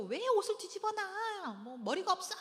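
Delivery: hammed-up scolding wail; nagging. Mother wanting to scold sons over inside-out clothes, mock nagging. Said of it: "막 잔소리하고 싶죠".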